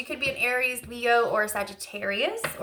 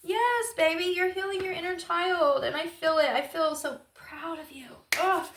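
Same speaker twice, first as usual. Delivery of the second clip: higher, tender, near tears; tearful tenderness; 6 semitones higher than usual. Real, not acted. To the viewer healing, tender and tearful. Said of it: "I just said I don't cry much".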